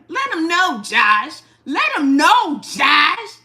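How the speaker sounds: loud, high cheering call; hype shout. Real, not acted. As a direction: cheer him on at full volume. Hyping to chat, shouting.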